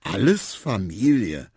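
slow, loud and proud. A narrator's reading. Little elephant showing off his family, slow proud announcement. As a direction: announce it proudly, stretching the words. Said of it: "sagte Quawa stolz".

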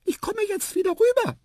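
very high, loud mouse voice; squeaky mouse. A narrator's reading. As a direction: pipe it across as a tiny mouse. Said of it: "piepste Tiputip". (laut piepsende Mäusestimme)

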